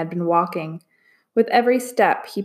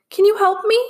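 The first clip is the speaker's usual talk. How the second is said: pleading; 13 semitones higher, 4 dB louder than usual. A narrator's reading. Trapped voice pleading.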